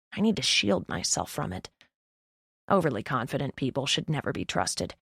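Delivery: plain reading voice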